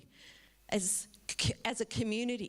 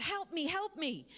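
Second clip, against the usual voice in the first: higher, half-acted plea of help me; a mild mock yell from someone drowning; lightly acted call for help; mock cry for help; 7 semitones higher than usual. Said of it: "more people are yelling out".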